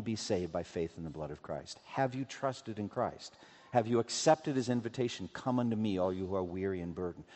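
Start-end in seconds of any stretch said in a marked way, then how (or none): none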